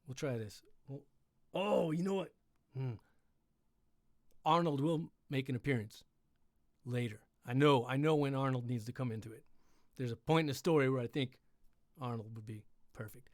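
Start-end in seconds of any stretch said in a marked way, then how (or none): none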